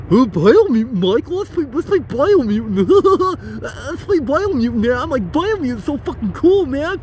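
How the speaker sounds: high, whiny, loud mock viewer voice; nagging fan voice, high and loud; mocking a pushy viewer. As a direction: whine it like an annoying fan begging.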